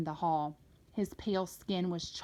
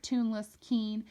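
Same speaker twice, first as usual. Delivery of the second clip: ordinary delivery, nothing marked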